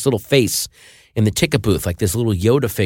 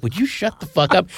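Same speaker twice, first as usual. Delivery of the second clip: higher-pitched retold complaint; annoyed moviegoer; 8 semitones higher than usual. Moviegoer turning round to shush the talker, mock annoyed. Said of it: "turn around and go".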